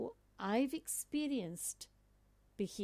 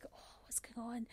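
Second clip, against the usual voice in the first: whispered; 11 dB quieter than usual. Retold or imitated. Whispering while spotting the commotion.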